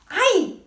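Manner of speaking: high "I" like "aye", a yelp of pain; painful long I. Shown as a demonstration. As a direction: say "I" as if it hurts. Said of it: "Long I hurts".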